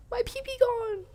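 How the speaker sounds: high, loud mock-panicked squeak. Streamer jokes to chat in a squeaky high voice. Joking isekai gender swap bit.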